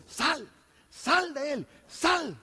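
rising, urgent command to leave; disciples commanding. Retold or imitated. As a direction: order the demon out, mildly acted. Discípulos reprenden al demonio. (discípulos ordenando, subiendo)

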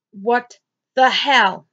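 normal talking voice

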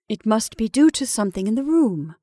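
ordinary delivery

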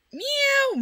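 high squeaky meow; cat meow. Pretend. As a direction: meow like a kitten. Cat noise.

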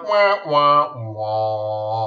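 mock sad trombone, falling; sad trombone wah wah. Host mocking his failed trade plan, sad trombone. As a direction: sing the failure sound, drooping.

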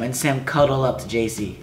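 ordinary delivery

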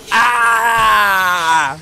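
imitating a loud pained yell; hammed-up loud cry of pain; mock pained scream. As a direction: act out the theater guy's loud scream. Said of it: "Und der dann auch so".

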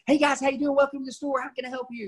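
high, eager car salesman spiel; pushy salesman pitch, mock; fast sales pitch. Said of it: "that fast pitch".

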